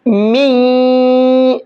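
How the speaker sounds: می with the nunation merged into the ی and nasalised; nasal merge with ya. Shown as a demonstration. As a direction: say می, blending the n into ی with a ghunna. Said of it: "کیسے پڑھیں گے؟". (تنوین کو یا میں ملا کر غنہ کے ساتھ می)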